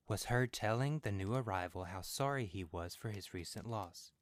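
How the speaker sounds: ordinary delivery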